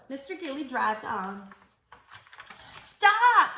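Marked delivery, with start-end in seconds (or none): 2.8-3.5 shouted stop. Acted.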